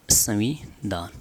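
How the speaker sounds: संविधान broken up as संवि, धान; slow dictation. Shown as a demonstration. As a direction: dictate संविधान slowly, piece by piece. Teacher dictates term in parts. (धीरे, टुकड़ों में लिखवाते हुए)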